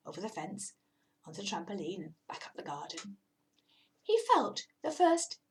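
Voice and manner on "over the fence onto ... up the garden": fast, hushed whisper. A narrator's reading. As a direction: breathe the route out quickly. Narration slips into whisper.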